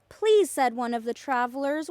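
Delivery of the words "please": high, loud begging please; pleading. A narrator's reading. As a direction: plead at the door. Hungry traveler begs at door.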